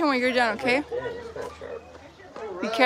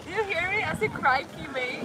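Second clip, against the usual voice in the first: softly tossed-off mock Australian accent; attempted Aussie accent; 5 semitones higher, 9 dB quieter than usual. Partner showing how to try the accent, mock Aussie. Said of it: "Can I try?".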